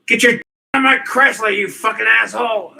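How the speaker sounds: high, loud, fast movie rant; shouting a Jim Carrey movie line; Jim Carrey quote. Retold or imitated. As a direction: yell it like the manic movie line. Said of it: "that one Jim Carrey movie".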